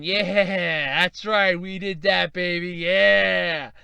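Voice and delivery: smug self-congratulating gloat, drawn out at the end; Microsoft. Acted. Microsoft sitting pleased after showing its price, smug gloating. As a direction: revel in it, stretching the last yeah. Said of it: "Microsoft's sitting there going".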